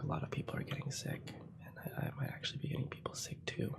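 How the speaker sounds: hushed, guilty whisper; soft unvoiced confession; whispered aside. Real, not acted. Confessing she may spread illness.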